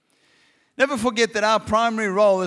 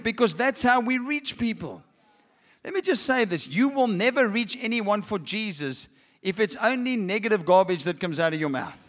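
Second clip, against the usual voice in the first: ordinary delivery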